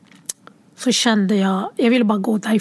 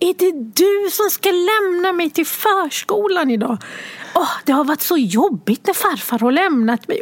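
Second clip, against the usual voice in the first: put-on higher, eager child voice; chatty little girl; 6 semitones higher than usual. Mother imitating her daughter chattering about preschool, higher child voice. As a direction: ramble on like a lively five-year-old. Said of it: "säger hon så här".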